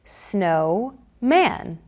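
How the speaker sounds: stretched out in two parts; slow segmented. Shown as a demonstration. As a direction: say snow and man slowly apart. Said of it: "here's how the magnetic blocks are used for blending two syllables".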